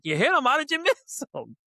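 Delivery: high, disbelieving with a laugh; stunned that the driver missed seeing him; incredulous. Retold or imitated.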